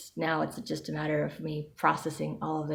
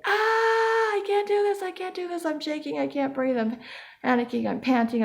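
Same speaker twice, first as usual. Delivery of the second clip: panicking body voiced; 12 semitones higher, 5 dB louder than usual. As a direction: play the body freaking out in a squeaky voice. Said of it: "your body is like".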